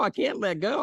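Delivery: high, fading I can't let go; lightly acted panic; whiny refusal again. Man keeps refusing.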